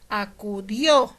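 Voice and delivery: teacher's slow a-cu-DIÓ; stress on final DIÓ. Shown as a demonstration. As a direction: stretch "Acudió" and punch the last syllable. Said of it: "En este caso sí lleva tilde".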